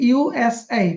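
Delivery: slow USA, each letter set apart. Shown as a demonstration. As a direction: spell out USA as three clear letters. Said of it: "অক্ষর অক্ষর উচ্চারিত করছি".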